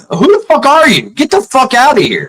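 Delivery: high-pitched retold outrage; angry confrontation. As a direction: act out ordering an intruder away. Retold confronting the hysterical woman.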